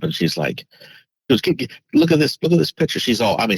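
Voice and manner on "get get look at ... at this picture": higher, urgent retelling of a panicked plea; mock-frantic, pushing him to look; imitating her freaking out. Girlfriend urging him to see the photo, mildly frantic. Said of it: "she's freaking out".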